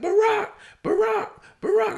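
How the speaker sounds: high, loud call for a runaway dog; imitated yelling. Owners call after their loose pit bull, imitated high yell. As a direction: mimic an owner hollering the dog's name. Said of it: "I heard someone yelling".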